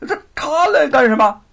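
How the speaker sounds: sharp, high and loud startled question. A narrator's reading. Luo Pang, startled by news of the prefect, blurts out in surprise. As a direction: caught off guard, blurting it out. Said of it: "罗庞一愣".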